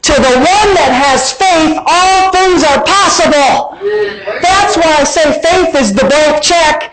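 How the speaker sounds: high, slow, fervent preaching. Acted. Preaching at full force.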